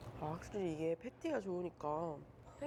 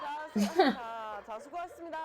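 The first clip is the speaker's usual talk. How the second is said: loud call; 6 semitones higher, 9 dB louder, slower than usual. Real, not acted. Calling out the wrap-up.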